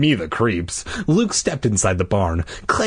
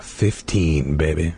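low-pitched, laid-back tag; deep, casual chapter number; mock-cool announcement; 8 semitones lower, slower than usual. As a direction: drop the voice low after the squeal. Comic low finish to chapter heading.

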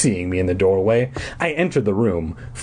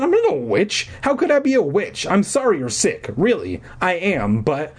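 high-pitched defensive pleading; protesting; 8 semitones higher than usual. Acted. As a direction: protest high, easing down toward the end. Narrator's character protests the accusation.

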